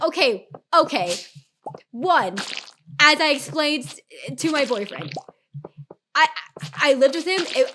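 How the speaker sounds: high, loud exasperated telling-off; heated retort. Retold or imitated. Streamer retells telling the dude to butt out, heated. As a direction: lay out each point forcefully, fed up. Retold quarrel with the meddling dude.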